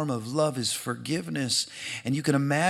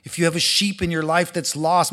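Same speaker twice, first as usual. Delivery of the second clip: ordinary talk, nothing marked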